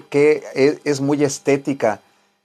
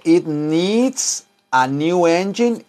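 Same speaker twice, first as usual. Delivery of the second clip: careful teacher read; "it needs a new engine" with a clear S on "needs"; slow model sentence; 3 semitones higher, much slower than usual. Shown as a demonstration. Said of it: "cuando dice". (lectura modelo pausada)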